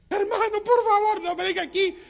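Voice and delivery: high, loud desperate plea; high begging. Acted. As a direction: beg him not to drive off. Chanito begs not to be left.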